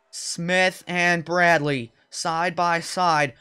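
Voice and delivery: slow, drawn-out race call; suspenseful play-by-play. Real, not acted. Announcer calls cars side by side, slow suspenseful commentary. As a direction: stretch each word to build suspense.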